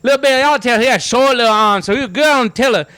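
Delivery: squeaky backwoods character voice; high-pitched mock Southern hillbilly. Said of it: "He had that voice".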